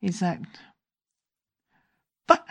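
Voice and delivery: usual speaking voice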